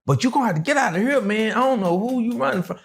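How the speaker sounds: high, fast, anxious get out of here; high, rushed nervous warning; nervous urging. Retold or imitated. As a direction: hurry him out of the house. Homeowner urging him out.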